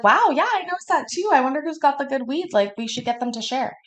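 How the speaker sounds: high, sing-song fake innocence; mock innocent. Stoner to bosses about weed, mock innocent voice. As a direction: play dumb, bright and high.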